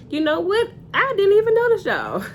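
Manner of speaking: a touch of a breezy, dismissive husband; mock innocent. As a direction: toss it off like it never crossed his mind. Eric voiced, dismissive.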